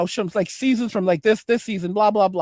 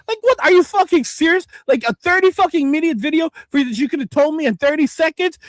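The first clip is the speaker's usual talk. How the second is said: louder, higher outraged disbelief; angry rant; 4 semitones higher, 3 dB louder than usual. Real, not acted. Streamer venting to chat about long videos, outraged and loud. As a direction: blow up at the wasted half hour. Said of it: "the passion and the anger and the frustration".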